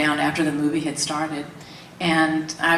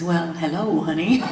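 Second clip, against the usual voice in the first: flirty greeting. Retold or imitated. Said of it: "His whole demeanor changed".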